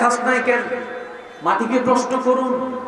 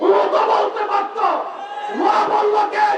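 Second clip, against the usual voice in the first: rising sermon cry; 8 semitones higher than usual. Acted. Preacher's rising rhetorical challenge.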